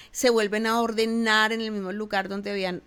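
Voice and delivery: usual speaking voice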